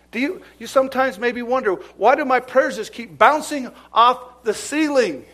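high, loud preaching; preaching at full force. Acted. Preacher challenging the church, high and loud. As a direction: press the question hard.

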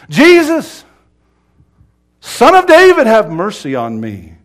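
full-throated high scream that falls at the end. Retold or imitated. Blind beggar refusing to be hushed, full scream. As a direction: scream the name, pause, then scream on and drop away. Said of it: "he screamed all the louder".

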